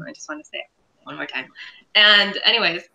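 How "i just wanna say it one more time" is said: softer, quick repeat under the breath; tossed off much softer and faster; quiet fast aside. Real, not acted. Podcaster repeating the line to friends, softer and quick.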